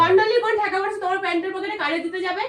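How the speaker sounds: high, loud incredulous yell; angry shouting. Acted. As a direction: yell in disbelief. Fake quarrel.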